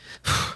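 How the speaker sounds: short voiceless huff; acted-out irritated sigh; exasperated huff. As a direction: let out a fed-up sigh. Acting out his pissy reply.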